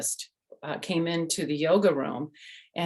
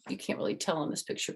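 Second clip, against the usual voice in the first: usual speaking voice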